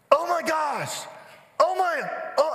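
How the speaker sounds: high, loud re-enacted panic; panicked cry. Dad who just hurt his son's arm, in retold loud panic.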